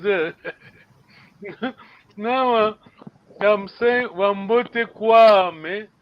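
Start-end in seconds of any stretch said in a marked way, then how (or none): 3.4-5.9 "Kwame" drawn out with a rise, very slow; teacher stresses "Kwame" slowly; slow, stressing Kwame. Shown as a demonstration. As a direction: spell out "Wambote Kwame" slowly for students. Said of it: "I'm inserting Kwame".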